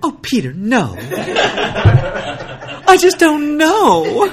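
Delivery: high, drawn-out swooning plea; breathy dramatic actress. Retold or imitated. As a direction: ham up a melodramatic heroine. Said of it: "I like when she gets all".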